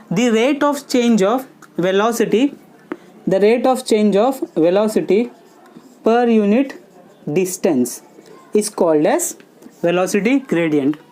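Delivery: slow, measured phrases with long pauses; slow dictation. Real, not acted. Teacher to students writing down a definition, slow dictation. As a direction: dictate it piece by piece, unhurried.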